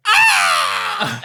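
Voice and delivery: sudden high screeching crow shriek; crow scream. Acted. The ripped note shrieks like a crow, high and loud. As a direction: let out a torn-paper bird screech. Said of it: "you hear, like".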